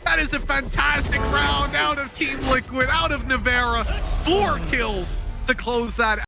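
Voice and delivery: fast, high, excited esports commentary; hyped play-by-play. Real, not acted. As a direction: call the clutch at full energy.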